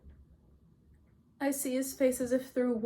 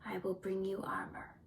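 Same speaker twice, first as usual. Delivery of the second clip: soft, whispery, toneless; subdued offer; 2 semitones lower, 7 dB quieter than usual. A narrator's reading. Mother drawing back from her grieving son, hushed. Mother withdraws, cold flat voice.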